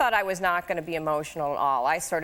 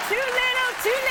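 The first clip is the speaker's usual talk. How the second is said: laughing quip. Real, not acted. Joking back to a compliment.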